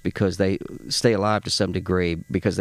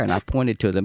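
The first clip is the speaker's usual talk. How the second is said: normal talking voice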